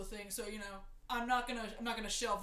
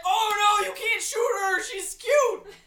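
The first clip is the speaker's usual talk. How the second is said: loud, much higher yelp; panicked shout; 10 semitones higher, 16 dB louder, slower than usual. Retold or imitated. Rude shouting at Reno not to shoot Tifa. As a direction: yell it to stop the shot.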